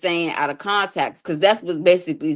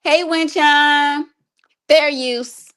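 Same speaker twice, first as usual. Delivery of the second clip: slow, high, loud hustler bravado; hustler muscular voice; 9 semitones higher, 5 dB louder, much slower than usual. Pretend. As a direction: puff up like a street hustler. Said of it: "in my, uh, hustler muscular voice".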